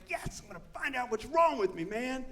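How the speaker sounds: high, eager, mock-thrilled; giddy anticipation; excited. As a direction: sound like a kid on Christmas morning. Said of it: "I'm excited".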